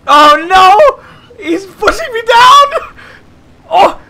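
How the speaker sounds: high, loud laughing panic cries; half laughing, half screaming in panic; panicked shouting. Real, not acted. Plane pushing him down.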